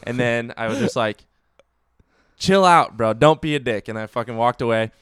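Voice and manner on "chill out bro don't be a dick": loud, higher, heated parting shot; retold angry warning. To the rude Target worker, loud heated warning. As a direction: tell him off and walk away.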